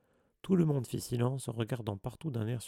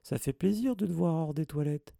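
higher, put-on pleasantry; falsely cheerful; 5 semitones higher, 3 dB louder than usual. A narrator's reading. Hermione flatters Mimi out of her toilets, falsely cheerful. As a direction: feign delight at seeing her. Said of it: "d'un ton faussement enjoué".

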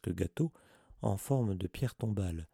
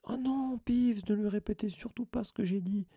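high, voiced urgent plea; hurried, anxious murmur; 10 semitones higher than usual. A narrator's reading. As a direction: plead in alarm, pitched well up. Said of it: "murmura précipitamment Hermione".

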